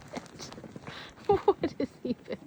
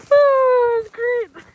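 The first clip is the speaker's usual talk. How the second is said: excited; 10 semitones higher, 11 dB louder, slower than usual. Real, not acted. After falling, giddy and loud.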